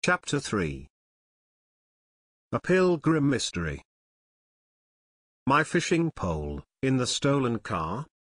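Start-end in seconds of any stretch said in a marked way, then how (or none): none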